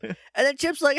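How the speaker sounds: ordinary delivery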